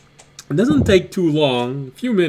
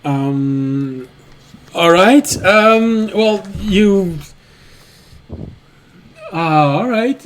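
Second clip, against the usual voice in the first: lightly flustered innkeeper, raised voice, halting; a bit thrown, stalling between louder little bursts; caught off guard; 4 dB louder, much slower than usual. Acted. Innkeeper suddenly tossed two silver by a guest, mildly flustered.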